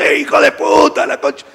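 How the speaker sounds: high, loud mock yell of abuse; gritos imitados. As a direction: imitate coaches screaming insults from the touchline. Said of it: "los profes están gritando". (grito agudo imitando a un técnico que insulta)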